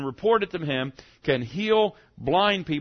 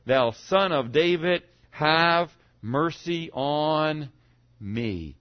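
slow, drawn-out plea, each word stretched; insistent cry; 3 semitones higher, much slower than usual. Acted. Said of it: "cry out a great deal more".

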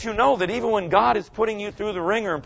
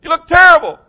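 coach yelling; 11 semitones higher, 12 dB louder than usual. Retold or imitated. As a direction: bark at the players in a timeout. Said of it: "and you go".

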